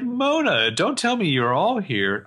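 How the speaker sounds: high swooping name, slowed follow-up; mock surprise. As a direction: act out an exaggerated surprised greeting. Acting Jenna greeting Mona.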